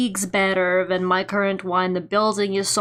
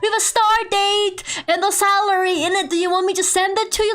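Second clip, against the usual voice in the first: much higher, sing-song phone politeness; customer service voice; 10 semitones higher than usual. Retold or imitated. Telling the doubtful agency about the contract, chirpy customer service voice. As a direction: chirp it sweetly like a call centre. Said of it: "my customer service voice".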